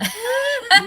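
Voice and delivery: a short high burst of laughter. Real, not acted. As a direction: laugh it off brightly. Laughs at own remark.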